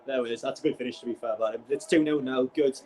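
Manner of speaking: ordinary talk, nothing marked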